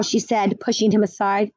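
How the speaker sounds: ordinary delivery, nothing marked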